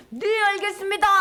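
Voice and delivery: high, crisp acknowledgement of orders; acted underling taking an order; obedient soldiers. Soldiers obey the high priest.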